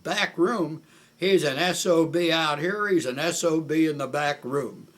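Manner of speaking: high, very loud outraged comeback; retold bellow, indignant to the end; imitated raving. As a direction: holler it so the whole street hears. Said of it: "he had a voice you could hear for miles away".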